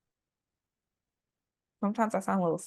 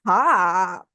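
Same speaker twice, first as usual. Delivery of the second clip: puzzled echo; 7 dB louder than usual. Retold or imitated. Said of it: "They'll be like".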